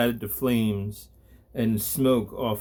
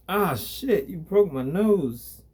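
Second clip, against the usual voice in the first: higher, whiny thug whining over his broken nose; a wounded thug complaining, pitched up; pained thug; 7 semitones higher, slower than usual. Acted. Thug with broken nose whines at the angel, pitched up.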